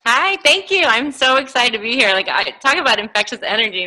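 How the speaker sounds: ordinary talk, nothing marked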